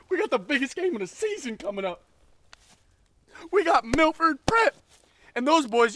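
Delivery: high, loud barking football coach; yelling ghost coach. Acted. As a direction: scream drills at your player like a coach. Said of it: "he comes up and he goes".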